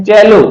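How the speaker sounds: चेलो with a ch, not s; English pronunciation. Shown as a demonstration. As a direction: say चेलो as "CHEL-oh", with a ch sound. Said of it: "इसका उच्चारण होता है".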